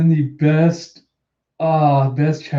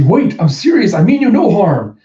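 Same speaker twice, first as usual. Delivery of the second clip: loud, raised-pitch pleading; urgent plea; 5 semitones higher, 7 dB louder than usual. Acted. Yamato to attacking Luffy, loud urgent plea. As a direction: make the attacker stop and listen. Acted plea during fight.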